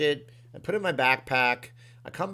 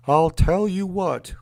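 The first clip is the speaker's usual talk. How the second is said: loud, slow, higher Texas cartoon dad; Hank Hill; 6 semitones higher, 9 dB louder, slower than usual. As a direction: belt out a hammed-up Texan catchphrase. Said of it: "I'll do a Hank Hill".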